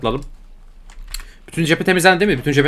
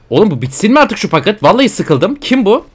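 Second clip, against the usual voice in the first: high, loud fed-up outburst; exasperated shout; 7 semitones higher, 5 dB louder than usual. Real, not acted. Gamer yelling at a stalling enemy, loud and fed up. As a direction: yell at the screen in frustration.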